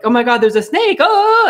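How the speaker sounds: high, loud mock panic; mock scream. Planning to fool Dwayne, loud mock scream. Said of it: "if I'm like".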